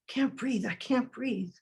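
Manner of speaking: breathless, quiet plea; hushed gasping plea. Acted. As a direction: gasp it out as if smothered. Quoting victim being smothered.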